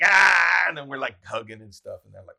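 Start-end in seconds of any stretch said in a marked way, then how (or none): 0.0-0.7 loud glad shout of recognition; happy yell. Retold or imitated. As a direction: roar hello to an old friend. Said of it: "he's like".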